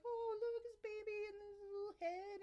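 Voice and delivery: put-on high-pitched gush over a baby; retold cooing. Mother first seeing the baby on the monitor, high cooing. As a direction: coo in a high, delighted voice.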